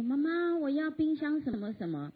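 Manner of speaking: put-on little girl asking. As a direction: imitate a three-year-old asking her mother. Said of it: "三岁的一个女儿就说".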